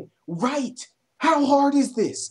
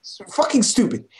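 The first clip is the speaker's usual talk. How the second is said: ordinary talk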